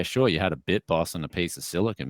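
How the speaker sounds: usual speaking voice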